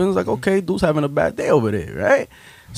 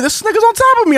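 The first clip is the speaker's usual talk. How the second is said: much higher, incredulous; worked-up disbelief. Retold or imitated. Telling friends about being pinned, worked up and high. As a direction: pitch it up like it's still happening.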